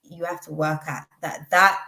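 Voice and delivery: ordinary delivery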